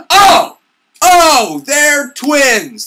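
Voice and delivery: loud, high burst of realization; sudden realization. Real, not acted. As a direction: shout the discovery out in shock. Realizing twist.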